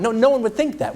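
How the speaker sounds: ordinary delivery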